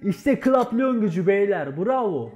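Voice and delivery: loud, joyful praise for the team; excited cheering. Real, not acted. Team captain cheering his teammates after a round win, loud and joyful.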